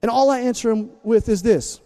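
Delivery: normal talking voice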